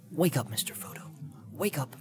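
gentle whispered call; soft waking whisper. Acted. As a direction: whisper him awake tenderly. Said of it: "he spoke softly to him".

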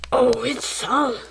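usual speaking voice

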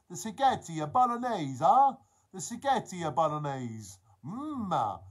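put-on lively, sing-song Italian accent. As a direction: ham up an Italian accent with relish. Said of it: "we all have an Italian accent".

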